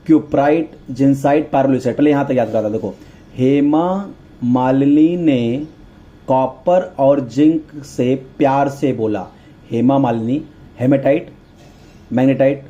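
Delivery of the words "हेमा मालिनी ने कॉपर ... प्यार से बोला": very slow, measured recitation; teacher slowly dictating a memory phrase; drawn-out mnemonic. Shown as a demonstration. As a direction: stretch each word so students can memorise it.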